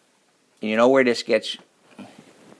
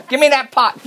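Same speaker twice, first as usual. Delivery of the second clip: snappy wife; 10 semitones higher, 4 dB louder than usual. Retold or imitated. Sulking wife snaps.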